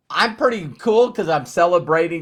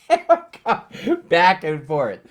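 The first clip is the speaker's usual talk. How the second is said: ordinary delivery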